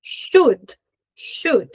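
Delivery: "should" clipped short, dry final d; short dry "should" ending on a stopped d. Shown as a demonstration. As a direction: say "should" crisp and dry, no vowel after d. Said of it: "a pronúncia é sempre sequinha".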